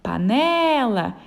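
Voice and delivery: panela with NE stretched long; calling the word. Shown as a demonstration. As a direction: draw out the middle syllable ne of panela. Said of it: "chamando a palavra". (chamando panela, com o né alongado)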